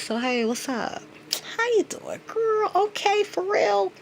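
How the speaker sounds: high, sing-song fake friendly voice; mock friendly greeting. Mimicking a fake friend's gushing hello, playful and high.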